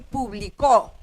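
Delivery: "publicó" con fuerza en la "có" final; acento en la última. Shown as a demonstration. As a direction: carga la voz en la última sílaba de "publicó". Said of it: "en la primera o la segunda sílaba. No puede estar en la tercera". (acento agudo en "publicó", golpe en la "có")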